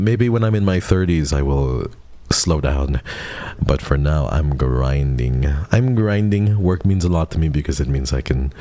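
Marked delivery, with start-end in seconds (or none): 4.3-5.5 slow declaration. Shown as a demonstration. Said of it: "repeated declaration, slow then loud". 5.7-6.6 pumped up. Shown as a demonstration. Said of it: "second take, higher and firmer".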